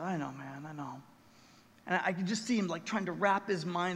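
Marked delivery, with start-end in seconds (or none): 0.0-1.0 put-on soft, low reassurance to a grieving child; quietly comforting a little boy who lost his mom; gentle comfort. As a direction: hush it tenderly, much softer. Comforting grieving three-year-old boy.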